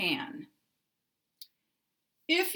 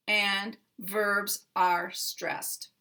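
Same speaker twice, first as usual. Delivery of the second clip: usual speaking voice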